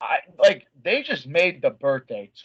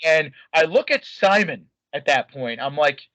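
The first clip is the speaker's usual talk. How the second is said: usual speaking voice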